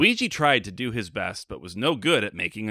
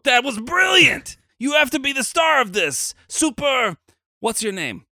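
high, loud, delighted shout; thrilled director; 13 semitones higher, 5 dB louder than usual. Acted. Director overjoyed by Mario's accent, shouting high and loud. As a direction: gush over the audition like a star was found.

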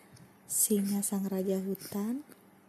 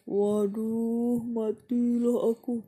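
panicked lion; 5 dB louder, slower than usual. Acted. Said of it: "kata singa dalam hati".

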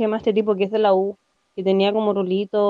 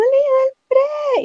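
much higher, slow, wailing squeal; crying-out squeal; slower than usual. Retold or imitated. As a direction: cry out like a starstruck fan. Reliving a fangirl squeal. (chillido lento y mucho más agudo)

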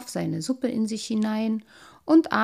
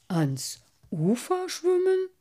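high, drawn-out puzzled question; amazed carp, voice climbing; astonished; 11 semitones higher, slower than usual. A narrator's reading. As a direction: echo it in slow disbelief, pitch leaping up. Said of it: "fragte Cyprinius verwundert".

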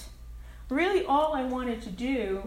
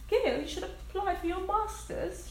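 put-on a light Indian accent, pitched up; 7 semitones higher than usual. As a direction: lightly imitate an Indian woman's lilt. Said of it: "She's got this great accent".

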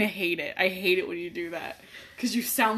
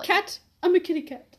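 high, squeaky kitty voice; cat voice; 9 semitones higher than usual. Pretend. Voicing the cat.

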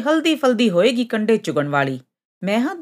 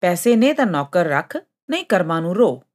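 usual speaking voice

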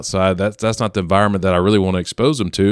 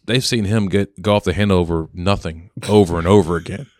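normal talking voice

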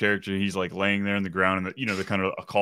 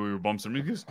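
ordinary talk

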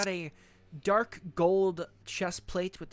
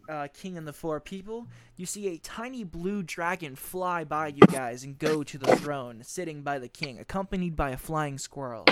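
ordinary talk

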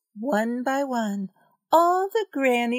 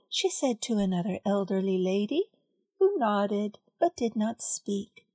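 ordinary delivery, nothing marked

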